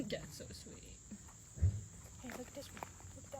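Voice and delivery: hushed whisper near the dog. Real, not acted. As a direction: breathe the words softly. Whispering while filming the dog.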